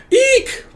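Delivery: very high, short "eek"; mock frightened squeak. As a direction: squeal as if finding a body. Acted shriek on finding the body.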